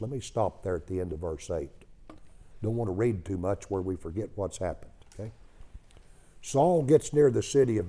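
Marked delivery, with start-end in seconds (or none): none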